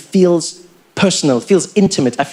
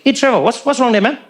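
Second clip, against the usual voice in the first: put-on higher-pitched Indian-accented seatmate; 4 semitones higher than usual. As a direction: play an Indian passenger with an Indian accent. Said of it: "There's this Indian guy sitting next to me".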